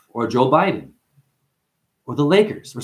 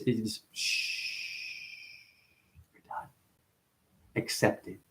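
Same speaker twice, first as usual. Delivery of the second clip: breathy shushing whisper, barely voiced; hushed whisper; 3 semitones lower, 16 dB quieter, much slower than usual. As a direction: hush them like a secret. Hushing the listener to silence.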